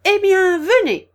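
high, very loud yell; bellowed. A narrator's reading. Queen summoning Alice to play, yelling. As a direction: yell the command out. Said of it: "hurla la reine".

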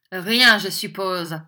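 loud, slow mocking supposition; diabolical sneer. A narrator's reading. Schoolmaster to his cowering victim, diabolical sneer. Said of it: "grimaçant un rire diabolique".

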